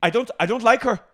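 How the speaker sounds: high, loud Irish lilt; imitating Vicky in an old Irish accent; mock old Irish innkeeper. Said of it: "She's an old Irish innkeeper".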